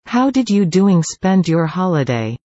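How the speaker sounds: usual speaking voice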